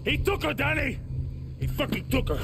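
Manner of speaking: shouting at Danny in alarm; distraught shout. Acted. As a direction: yell it in frantic anger. Panicked news of kidnapping.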